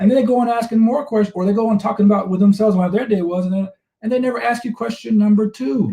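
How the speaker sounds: high, animated complaint; on a roll, voice riding high; fired up. Real, not acted. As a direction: run it off, all worked up. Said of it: "He's fired up today".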